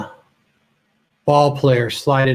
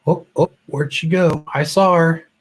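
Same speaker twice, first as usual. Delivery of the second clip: normal talking voice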